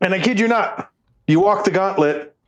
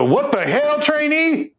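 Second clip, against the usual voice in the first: mock drill sergeant; 10 semitones higher, slower than usual. Drill instructor yelling at trainee.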